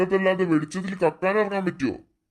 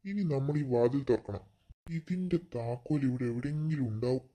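softer, keeping it down like a thief; hushed voice; 4 semitones lower, 7 dB quieter than usual. Acted. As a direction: talk low so nobody hears. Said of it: "നമ്മൾ പതുക്കെ സംസാരിക്കാൻ".

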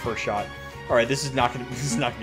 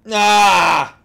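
long, loud, raised-pitch cry of delight; excited yell; 7 semitones higher, 11 dB louder than usual. Real, not acted. Streamer overwhelmed by a favorite character, excited yell. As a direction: let out a big fan's holler.

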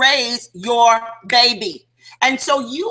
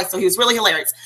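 ordinary talk

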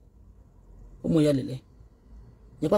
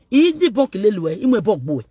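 shouted, much higher jeering cry; loud taunt; 6 semitones higher, 8 dB louder than usual. Acted. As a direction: yell it out to mock him. Taunting outburst about debunking.

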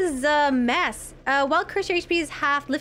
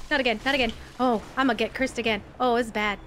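normal talking voice

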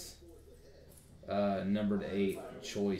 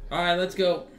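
hyped; 9 semitones higher, 12 dB louder than usual. Real, not acted. Hyped start of the break.

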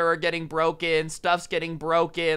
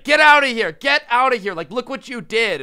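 much higher, louder furious yell; host angrily screaming at his helpers; retold screaming; 7 semitones higher, 8 dB louder than usual. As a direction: scream them out of the house. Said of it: "He's screaming at us to get out".

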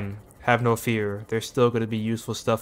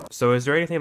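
ordinary talk, nothing marked

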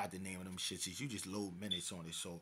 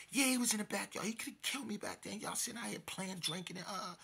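very high, indignant mock yelling; frantic outburst; 11 semitones higher than usual. Retold victim berates friends who sat drinking, frantic. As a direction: imitate a panicked man scolding his friends.

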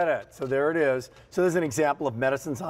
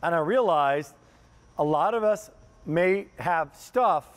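usual speaking voice

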